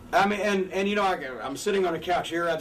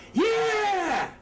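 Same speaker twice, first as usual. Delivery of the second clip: hammed-up babyface yell, pitched way up; high fired-up yell; 12 semitones higher than usual. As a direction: whip up the crowd with a big high yell. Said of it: "they're going".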